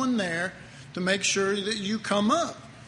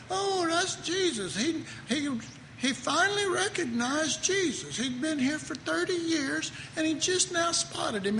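much higher, startled voice of a God who has only just noticed; clueless God voice; 9 semitones higher than usual. Pretend. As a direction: play God as a dithering old man who has just spotted his son. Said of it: "let's hope God doesn't talk like that".

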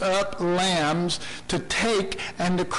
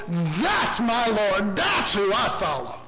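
fervent declaration; slower than usual. Acted. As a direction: proclaim it to the whole church. Preacher's climax of worship.

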